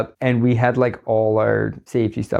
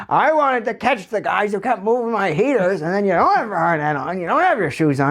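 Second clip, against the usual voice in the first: high, fast cartoon rant; mock-outraged older boss scolding; fuming safety guy; 9 semitones higher than usual. Safety guy catching worker without boots, high-pitched mock scolding. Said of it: "steam shoots out of his ears".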